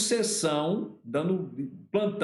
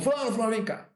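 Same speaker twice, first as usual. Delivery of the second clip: chama alguém num grito encenado, mais agudo; grito de chamado imitado; 5 semitones higher than usual. Retold or imitated. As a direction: imite o chefe dando ordem aos gritos, sem exagerar. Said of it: "Deu um grito, chamou". (chamado mandão imitado)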